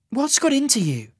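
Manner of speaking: high-pitched demanding question. A narrator's reading. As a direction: demand an answer, voice right up high. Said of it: "she demanded".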